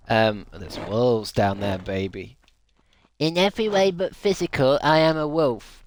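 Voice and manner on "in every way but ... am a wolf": higher, louder smug self-proclaimed wolf; mock wolf person. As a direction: ham up a proud wolf wannabe. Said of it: "someone cringe being a wolf".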